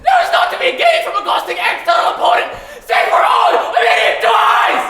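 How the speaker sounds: very high, loud shrieking plea; frantic squeal. Acted. Pig two shrieks at pig three to keep the door shut. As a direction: screech it while bracing the door. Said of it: "squealed two".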